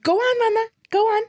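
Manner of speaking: high, squeaky imitation of mom; mimicking mom. Daughter mimics mom urging her on, in a high squeaky voice. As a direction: pitch way up as mom. Mom's reply mimicked in story.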